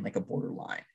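"like a border line" breathed in a soft whisper, no voice; whispered aside. Real, not acted. Repeated phrase, voice drops away.

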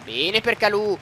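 usual speaking voice

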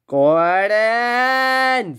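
long, high scream of the name; drawn-out acted outraged yell. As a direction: scream his name, holding the last syllable. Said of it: "screamed Edward".

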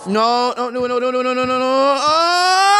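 rapid run of noes rising into a shout; mounting panic. Real, not acted. Play going wrong in game.

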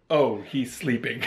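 mock hushed realization. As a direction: pretend to back off from a sleeper. Imagined visitor finds him asleep.

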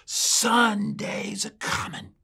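high, slow, drawn-out answering cry; congregation shouting back. Retold or imitated. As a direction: call it back like a whole church. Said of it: "the whole church shouted back at him".

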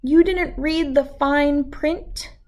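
ordinary delivery

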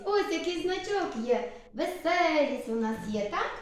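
ordinary talk, nothing marked

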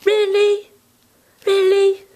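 high, mock-incredulous repeated really; imitating the lady's teasing disbelief; lady's doubtful really. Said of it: "She likes to go".

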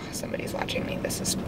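real nervous whisper in a parking lot. Real, not acted. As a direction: whisper it so the stranger can't hear. Notices someone watching her.

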